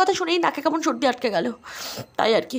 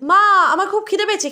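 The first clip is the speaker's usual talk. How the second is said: ordinary delivery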